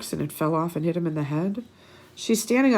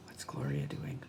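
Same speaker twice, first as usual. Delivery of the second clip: hushed, low, curious whisper; secretive storytime whisper; 14 dB quieter than usual. Real, not acted. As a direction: lean in and whisper the question. Whispered aside to kids.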